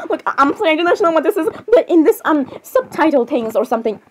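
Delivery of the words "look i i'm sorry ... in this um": ordinary talk, nothing marked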